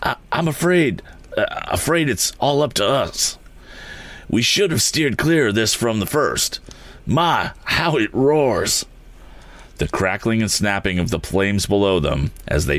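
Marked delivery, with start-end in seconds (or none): 0.0-8.8 higher, halting, mildly frightened; gasping fear. A narrator's reading. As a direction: stammer it out, a touch breathless with fear. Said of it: "gasping as he swallowed some smoke".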